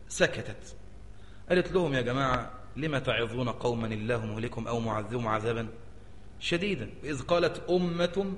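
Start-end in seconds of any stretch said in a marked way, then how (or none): 1.9-6.9 quiet recited question. Retold or imitated. Third group speaks quietly.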